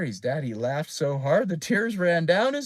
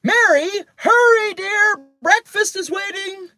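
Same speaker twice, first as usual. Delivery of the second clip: high, loud mother's call; 7 dB louder than usual. A narrator's reading. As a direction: call a child down to breakfast. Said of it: "her mother called".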